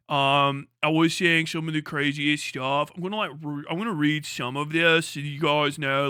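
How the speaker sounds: halting British YouTuber apology with drawn-out fillers; Pyrocynical. Acted. British YouTuber addressing leaks to his viewers, mock British accent. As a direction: hedge awkwardly in a put-on British accent. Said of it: "do the fucking Pyrocynical".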